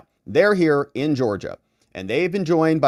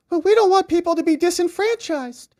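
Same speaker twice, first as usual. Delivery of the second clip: much higher, sing-song fake-concerned voice; mocking the groups; 4 dB louder than usual. Mocking lawsuit groups' fake concern, sing-song voice. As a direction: put on a mock-innocent tone. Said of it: "they would say".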